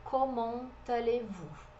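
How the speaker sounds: Comment allez-vous slow and careful; slow French demo. Shown as a demonstration. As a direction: model Comment allez-vous as a formal question. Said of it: "pergunta bastante formal". (Comment allez-vous devagar, formal)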